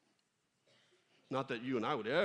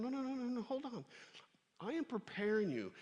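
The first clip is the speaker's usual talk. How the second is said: God reassuring; 8 semitones higher than usual. Pretend. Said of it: "God is going".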